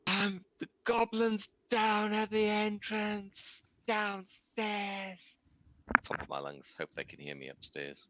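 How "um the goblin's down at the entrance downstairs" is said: high, drawn-out shout to distant friends; yelling in character. Acted. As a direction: call out slowly at the top of your lungs, pitched way up. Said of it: "I yell at the top of my lungs".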